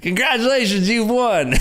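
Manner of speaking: high, loud cheesy ad announcer; mock a nineties pop-up ad; hammed-up prize announcement. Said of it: "You remember those pop-ups?".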